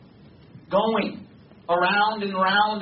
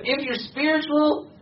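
high, slow mock-pious quoting; 9 semitones higher, slower than usual. Preacher parodying the myth about spiritual people, mock pious. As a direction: draw it out high, quoting a myth you hate. Quotes the hated myth again.

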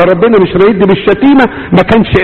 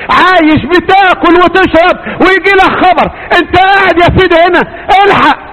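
full-force preaching; 12 semitones higher than usual. Acted. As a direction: preach it out high to the crowd. Preaching about sudden bad news.

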